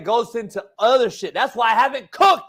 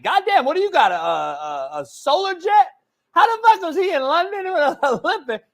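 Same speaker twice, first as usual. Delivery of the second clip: mock disbelief; 7 semitones higher than usual. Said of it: "I'm like".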